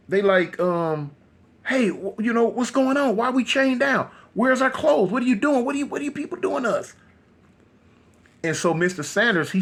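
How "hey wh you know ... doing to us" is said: high-pitched, agitated retold questioning; alarmed demand. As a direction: demand answers in a rising panic. Chained couple demand answers, upset.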